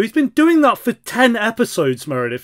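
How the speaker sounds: loud, high outburst at a character; shouting at the screen. Real, not acted. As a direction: yell it at the TV. Yelling advice at the show.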